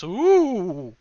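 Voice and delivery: spooky wailing ghost noise; a drawn-out haunted ooh. Pretend. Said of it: "doing the spooky noises such as".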